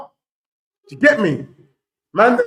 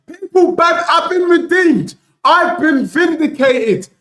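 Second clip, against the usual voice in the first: gloating shout; 7 semitones higher than usual. Real, not acted. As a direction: crow over the rivals at full voice. Gloating as Liverpool concede.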